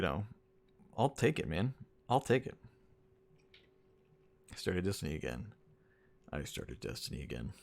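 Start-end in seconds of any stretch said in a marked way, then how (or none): 6.3-7.5 low, soft, deflated repeat; quieter, lower retake. Shown as a demonstration. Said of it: "gets worse and worse each time you say it".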